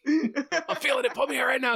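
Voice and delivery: high-pitched insistent tempter, laughter creeping in; mock urgent pleading.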